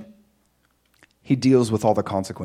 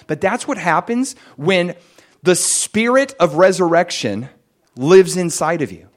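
ordinary delivery

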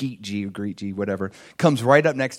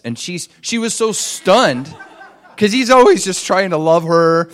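higher and louder through a laugh; laughing while telling; 6 semitones higher, 8 dB louder than usual. Real, not acted. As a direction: chuckle through the words. Narrator laughs recalling her shock.

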